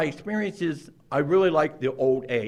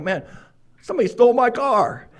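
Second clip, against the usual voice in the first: raised, louder retelling of the panicked call; retold alarmed call; 9 semitones higher, 5 dB louder than usual. Telling my best friend my car got stolen, alarmed and loud. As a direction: relive the moment you tell your friend.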